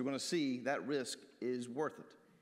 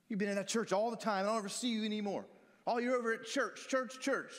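complaining parent; 6 semitones higher than usual. Retold or imitated. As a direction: whine about the church at your child. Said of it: "parents can do that".

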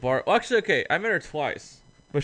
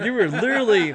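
much higher and louder, in disbelief; incredulous outburst; 8 semitones higher, 7 dB louder than usual. Retold or imitated. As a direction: throw it back at her, can't believe it. Retelling his own disbelieving retort.

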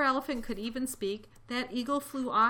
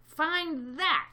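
higher, taunting bird; sneering; 7 semitones higher, 4 dB louder than usual. A narrator's reading. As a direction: throw it out as a sneering dare. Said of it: "sneered the be".

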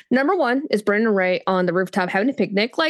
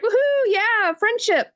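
high mock cheer for friendship; mock-excited whoop; 12 semitones higher, slower than usual. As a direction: cheer it like a kid at a party. Cheering a new friend for Rey.

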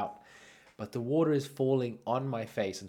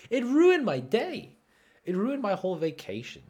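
high, loud mock wail; comic outrage; 12 semitones higher, 8 dB louder than usual. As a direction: wail it in exaggerated dismay. Comic outrage at being insulted.

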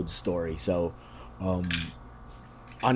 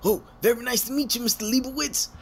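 much higher, fast, gushing; mock overpolite greeting; 12 semitones higher than usual. Imagined blurting the wrong name to Stan Lee, high and gushing. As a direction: gush it like a starstruck fan. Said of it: "if I would have been like".

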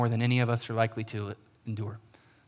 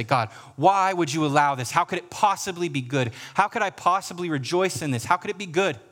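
higher, insistent plea; anguished questioning; 6 semitones higher, 4 dB louder than usual. Acted. Congregation bringing its questions to God, anguished pleading. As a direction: cry out in protest, voice pitched up.

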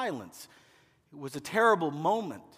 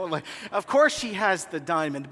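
usual speaking voice

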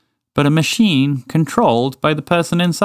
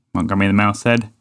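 ordinary delivery, nothing marked

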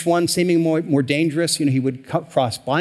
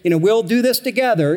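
eager helper; 7 semitones higher, 3 dB louder than usual. Retold or imitated. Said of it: "it's like".

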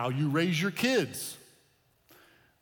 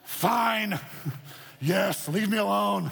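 a grumpy customer caving at the register; grudging groan; 5 semitones higher, much slower than usual. Retold or imitated. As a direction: sigh it out like a cornered customer. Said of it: "And you're like".

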